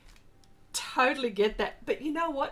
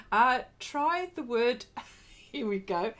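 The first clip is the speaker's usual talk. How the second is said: ordinary talk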